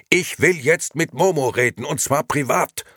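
loud, high, irritated demand; heated and loud, speeding up at the end; exasperated shouting. A narrator's reading. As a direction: insist at the end of your patience. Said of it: "brüllte Gigi aufs Äußerste gereizt".

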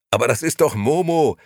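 a light laughing exclamation; laughing call. Acted. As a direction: call it out cheerfully, half laughing. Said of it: "rief Gigi lachend".

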